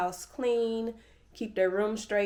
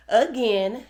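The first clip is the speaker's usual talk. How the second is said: laughing; 4 semitones higher, 7 dB louder than usual. Real, not acted. Laughing at being away.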